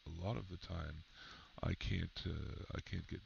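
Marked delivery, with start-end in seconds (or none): none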